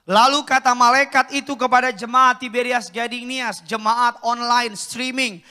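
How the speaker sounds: loud, ringing pulpit reading; booming sermon delivery; preaching at full force. Acted. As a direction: proclaim it to the whole church.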